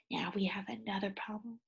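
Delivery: unvoiced suspense whisper. Real, not acted. Reader leans in to tell the children of trouble, whispering. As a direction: whisper it like a secret.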